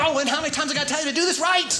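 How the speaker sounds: fast irritated scolding; exasperated coach. Retold or imitated. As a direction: snap at him for getting it wrong again. Snapping at own son.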